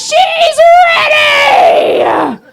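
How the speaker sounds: very high, loud hammed-up shout; mock shout. Instructor bellowing that the teller is ready. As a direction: stretch the yell out very slowly. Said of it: "And she goes".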